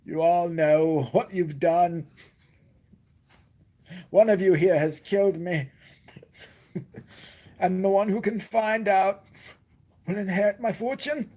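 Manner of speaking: high, slow, hammed-up accusation from the grave; dramatic will reading. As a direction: play the murdered millionaire addressing the heirs. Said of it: "one of those dramatic will readings".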